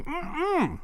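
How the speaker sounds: high closed-mouth "mm-mm" of refusal; sulky leprechaun's high hummed no; refusing hum. Acted. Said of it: "refuses to talk anymore".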